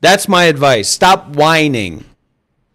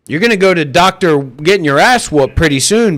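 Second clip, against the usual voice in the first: normal talking voice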